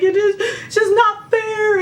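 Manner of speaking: high, slow, wailing complaint; a drawn-out mock sob; whiny crying. Crying to her husband on the phone, high and slow. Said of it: "boohoo crying".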